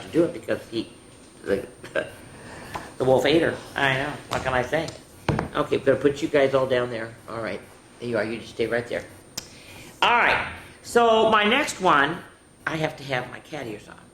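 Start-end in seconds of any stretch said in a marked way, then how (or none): none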